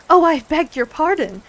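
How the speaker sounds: high, loud dismayed apology; great dismay. A narrator's reading. Alice apologizing to the upset jurymen, dismayed. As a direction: exclaim it in alarm and regret. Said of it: "exclaimed in a tone of great dismay".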